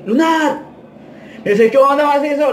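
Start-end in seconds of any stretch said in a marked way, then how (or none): 0.1-0.6 very high drawn call of a nickname; retold calling out. Yelling to his friend by nickname, calling out. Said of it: "le grité". 1.7-2.5 high-pitched call back; retold shouted reply. Guatemala answering his friend, calling out.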